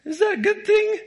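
squeaky high question breaking into a laugh. Retold or imitated. Preacher mock-asking whether sounding like his dad is good, laughing. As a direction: ask it through a giggle, voice shooting up. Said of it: "then I'm like".

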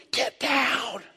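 high, strained cry to get down; mock panicked shout. Brothers to David from behind bushes, mock panicked yell. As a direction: yell it like frightened soldiers hiding.

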